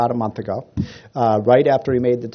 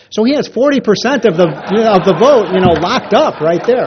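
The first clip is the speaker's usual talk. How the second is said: laughing; 7 semitones higher, 7 dB louder than usual. Real, not acted. Laughing at his own joke.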